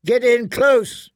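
high, loud, slow shout. A narrator's reading. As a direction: bellow it across the yard. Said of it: "he shouted".

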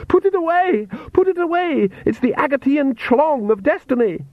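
very high-pitched frantic plea; panicked outcry. Acted. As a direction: cry out in horror, pitch shot right up. Girl panicking at a holy symbol.

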